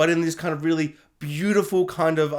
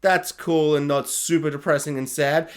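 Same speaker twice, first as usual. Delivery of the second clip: ordinary delivery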